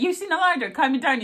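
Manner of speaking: tossed off with cheeky confidence; cheeky Doctor. Retold or imitated. Quoting the Doctor jumping on the roof, high and cheeky. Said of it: "he was like".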